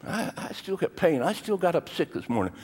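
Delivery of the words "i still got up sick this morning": usual speaking voice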